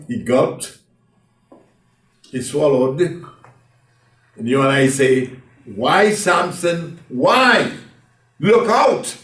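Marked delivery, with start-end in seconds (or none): 5.6-9.2 higher and louder, urgent slow call; warning cry. Acted. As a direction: shout a warning to a man about to fall. Congregation warning Samson.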